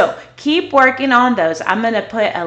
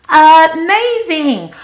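praise; 7 semitones higher, 8 dB louder, much slower than usual. Real, not acted. Praising child after the drill.

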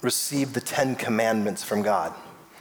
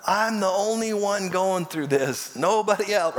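mock self-pity; 7 semitones higher than usual. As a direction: imitate someone wailing that they suffer alone. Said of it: "Oh, how we say that".

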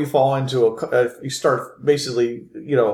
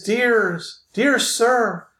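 much higher put-on female voice; tender lady; 11 semitones higher, much slower than usual. Lady speaks to wounded Philip, high tender voice. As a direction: address him tenderly, high and ladylike. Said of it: "you hear this female voice".

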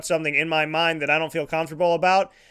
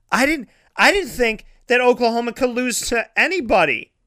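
sarcastic; 5 semitones higher than usual. Acted. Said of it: "And I'm being sarcastic".

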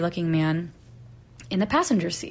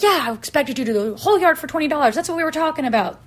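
higher, louder, fast quarrelling; indignant retort; 8 semitones higher, 5 dB louder, faster than usual. Retold or imitated. Homeowner haggling with the rake guy, indignant. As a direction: argue back, retelling the spat.

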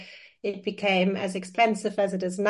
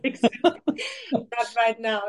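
laughing; 2 semitones higher, slower than usual. Real, not acted. Agreeing through laughter.

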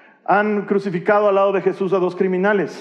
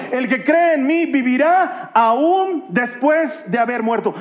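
slow, raised preaching voice with drawn-out key words; emphatic preaching; 4 semitones higher, slower than usual. Acted. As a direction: proclaim the verse slowly and forcefully. Preaches the verse at full force.

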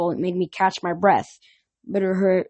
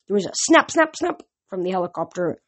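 usual speaking voice

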